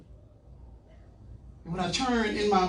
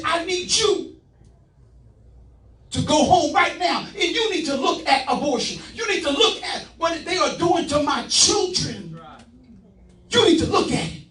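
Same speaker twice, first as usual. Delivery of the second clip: higher, louder urgent command; God's command; 8 semitones higher, 3 dB louder than usual. Retold or imitated. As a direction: command it like a divine order. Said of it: "and he said".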